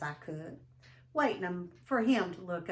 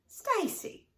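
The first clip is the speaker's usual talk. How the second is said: high, relieved cry of a name; a girl relieved to see her brother; relieved exclamation; 13 semitones higher than usual. A narrator's reading. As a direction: call out his name with relief. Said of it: "I exclaimed".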